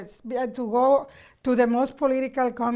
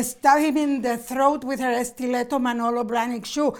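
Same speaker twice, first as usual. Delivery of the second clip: ordinary talk, nothing marked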